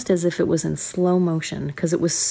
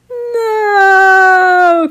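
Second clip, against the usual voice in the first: dismayed cry; 14 semitones higher, 9 dB louder than usual. Acted. Kids see the mess.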